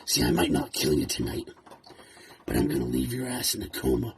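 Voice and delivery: a cold, hushed threat to Dewey; quiet menace. Acted. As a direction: pull it back and threaten low and quiet. Threats turn quiet after outburst.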